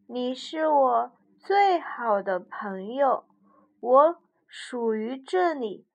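slow, sincere bear; slow and tender. Acted. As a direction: promise it slowly and warmly. Bear's tender promise.

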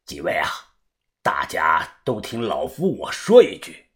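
put-on low, soft elderly voice; old master, deep and subdued; old man. A narrator's reading. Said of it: "偏爷大声地说道".

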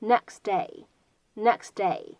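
"next day" with the final T dropped; lost plosion. Shown as a demonstration. Teacher demonstrating lost plosion in "next day". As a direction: run "nex" straight into "day", no T. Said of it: "又有了一个失去爆破的现象".

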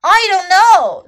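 high, very loud chimp voice; exasperated chimp. A narrator's reading. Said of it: "said Chimp".

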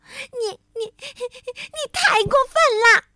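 high sobbing stammer building to shout; tearful outrage. A narrator's reading. Tiaotiao hurt by friend's words, tearful. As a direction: choke up, then cry out. Hurt, then bursts out crying.

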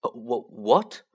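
slow, halting stammer; hesitant, stuttered question. Acted. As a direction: stumble over the word, taken aback. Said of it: "Harry stammered".

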